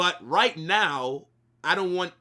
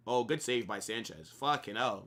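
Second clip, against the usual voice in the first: normal talking voice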